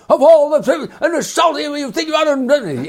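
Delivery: very high, loud angry rant, slowing at the end; a hammed-up shriek from the kitchen, like an old B movie; yelling at the chef. Restaurant owner ranting at his chef in the kitchen, high hammed-up yell. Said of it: "you hear him yelling at the chef".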